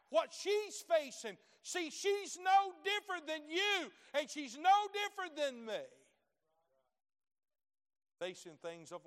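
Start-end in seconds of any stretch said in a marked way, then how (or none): 0.1-5.8 high, loud preacher's climax; preaching at full force. Acted. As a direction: drive the point home to the congregation.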